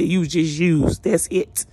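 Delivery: normal talking voice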